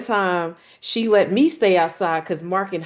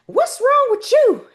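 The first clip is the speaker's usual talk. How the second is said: much higher, louder, slow worried cry; alarmed grandma; 5 dB louder, slower than usual. Retold or imitated. Grandmother seeing the hurt child, high alarmed call. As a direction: Nana startled by the child.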